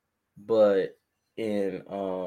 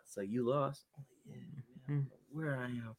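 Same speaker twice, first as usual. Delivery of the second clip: much softer hushed phone talk; whispering; 14 dB quieter than usual. Retold or imitated. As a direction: whisper so nobody hears. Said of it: "I'm whispering".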